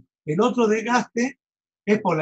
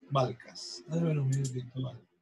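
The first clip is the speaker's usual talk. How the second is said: much softer, lower aside to one student; quiet aside; 5 semitones lower, 9 dB quieter than usual. Real, not acted. Teacher quietly asking Valcas for a moment, hushed aside. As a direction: drop the voice and ask for a minute.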